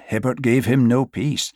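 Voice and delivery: ordinary delivery, nothing marked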